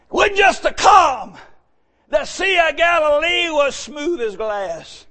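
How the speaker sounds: loud, slowed, drawn-out preaching. Acted. Preacher stressing the great calm to the church. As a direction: stretch each word for weight.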